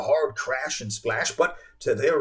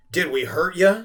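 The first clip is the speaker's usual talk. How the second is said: mock concern; 5 semitones higher, 5 dB louder than usual. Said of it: "in mock concern".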